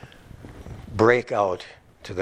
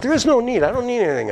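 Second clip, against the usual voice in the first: preacher's high ringing line; preached emphasis; 13 semitones higher, much faster than usual. Acted. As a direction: ring it out high.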